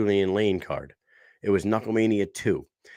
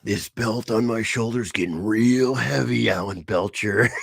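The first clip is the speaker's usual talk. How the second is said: higher, hammed-up wrestling promo voice calling out Mean Gene; 4 semitones higher than usual. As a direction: ham up a pro-wrestling champion's promo, belt on the shoulder. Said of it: "that wrestling promo with us".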